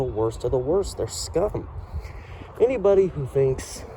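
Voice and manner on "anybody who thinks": ordinary talk, nothing marked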